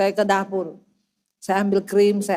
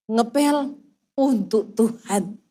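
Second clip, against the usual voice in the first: slow, low and measured; laboured devotion; 5 semitones lower, 3 dB quieter, much slower than usual. Retold or imitated. Wife mopping the floor for God, slow and deliberate. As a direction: spell out the devotion word by word.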